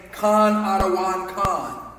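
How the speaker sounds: "Khan Adawan Khan" slow, each syllable clear; "Khan Adawan Khan" pronounced slowly and properly; careful slow pronunciation. Shown as a demonstration. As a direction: spell out "Khan Adawan Khan" deliberately. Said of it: "should be saying ... properly pronounce it".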